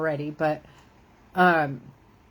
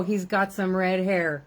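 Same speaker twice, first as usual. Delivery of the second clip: ordinary talk, nothing marked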